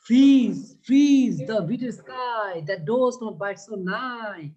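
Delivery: slow, drawn-out reading of the verse; poem recital. Shown as a demonstration. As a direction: read the line out slowly, like a poem. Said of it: "এরপর কি বলেছে দেখো তো".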